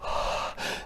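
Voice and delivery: an unvoiced breathy sigh for the "h" sound. Shown as a demonstration. Teacher showing children the "h" sound. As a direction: exhale the h like a sigh. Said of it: "H says".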